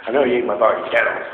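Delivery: retold confrontation, loud and insistent; mock accusation. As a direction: press him, half joking. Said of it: "I'm like".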